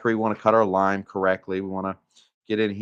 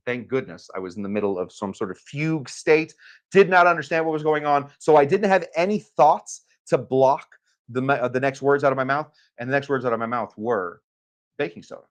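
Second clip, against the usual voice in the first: usual speaking voice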